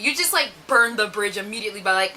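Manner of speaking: ordinary talk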